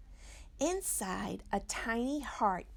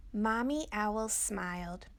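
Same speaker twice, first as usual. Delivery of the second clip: usual speaking voice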